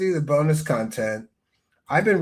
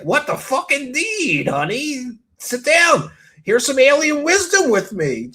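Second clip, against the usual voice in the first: mock excited invitation; 6 semitones higher, 5 dB louder than usual. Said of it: "And I was like".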